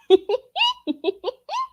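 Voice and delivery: a squeaky laugh; high-pitched laugh. Real, not acted. Reacting to an uncalled-for jab, a high laugh. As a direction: burst out laughing.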